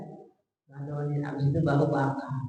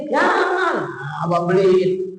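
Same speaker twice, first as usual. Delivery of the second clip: offended husband; 7 dB louder, slower than usual. Retold or imitated. Husband insisting he will buy it, offended and loud. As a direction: snap back with wounded pride. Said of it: "Tersinggung para suaminya".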